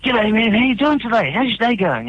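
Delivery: high-pitched, chirpy customer hello; acted cheery greeting, pitched well up; happy greeting. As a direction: imitate being the day's happiest customer. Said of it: "I'm all, like, happy".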